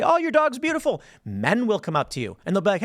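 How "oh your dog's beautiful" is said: admiring stranger. Retold or imitated.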